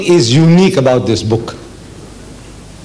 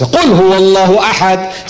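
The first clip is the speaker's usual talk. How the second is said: Quran recitation; much faster than usual. Acted. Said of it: "he doesn't talk like this".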